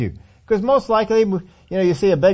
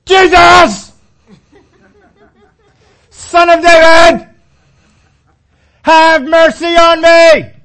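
high, loud, slow drawn-out cry; full shout; 11 semitones higher, 13 dB louder, much slower than usual. Retold or imitated. Blind Bartimaeus shouting to reach Jesus, full-throated yell. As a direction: bellow it across thousands of people. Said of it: "he is shouting as loud as he can".

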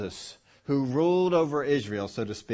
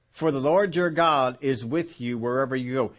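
usual speaking voice

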